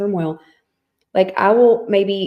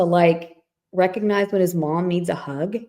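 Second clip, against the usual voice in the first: usual speaking voice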